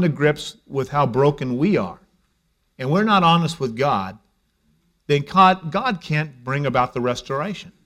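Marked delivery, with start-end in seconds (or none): none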